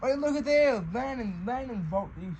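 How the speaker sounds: usual speaking voice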